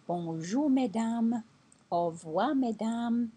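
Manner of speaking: mesdames lifted high at the end; bright French hello and goodbye to ladies; French greeting. Shown as a demonstration. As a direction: model the polite French greeting. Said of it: "So you'd say".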